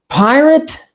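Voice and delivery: a high, incredulous "Pirate?"; mock surprise. Student stumbling on the word in the definition, mock surprised. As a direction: play the baffled student catching the odd word. Imagined student's puzzled reaction.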